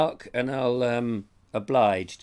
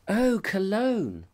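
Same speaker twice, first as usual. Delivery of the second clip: high, slow, dreamy perfume-ad purr; mock perfume advert; 8 semitones higher, slower than usual. Shown as a demonstration. Punning on a viewer's comment, mock perfume advert voice. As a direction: sell it like a luxury fragrance. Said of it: "It's a terrible joke".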